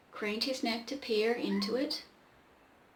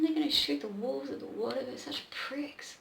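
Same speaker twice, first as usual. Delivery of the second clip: usual speaking voice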